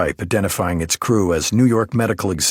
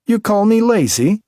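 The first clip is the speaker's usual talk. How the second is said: put-on much higher, louder offended Belarusian accent; 6 dB louder than usual. A narrator's reading. Alina snaps at the detective, offended, Belarusian accent.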